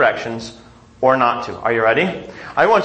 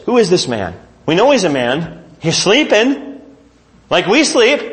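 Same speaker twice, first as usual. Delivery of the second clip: ordinary talk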